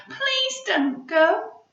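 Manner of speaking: slow, high, loud pleading little voice; begging. Acted. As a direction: drag out the plea. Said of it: "begged Pip".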